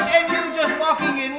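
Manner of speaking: ordinary talk, nothing marked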